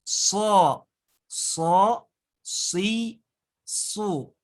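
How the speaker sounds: So, si, su with a sharp whistling hiss like a bird; whistling s sound. Shown as a demonstration. Teacher demonstrating the whistling letter sad to students. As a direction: make the s whistle like birdsong. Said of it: "cara pengucapannya kayak mau bersiul".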